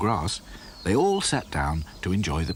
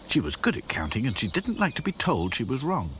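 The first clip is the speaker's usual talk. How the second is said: ordinary delivery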